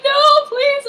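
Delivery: very high, loud, panicked plea; mock pleading sob. Claudia pleading with the masked doctor, in a high mock sob. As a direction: beg for mercy like a strapped-down patient.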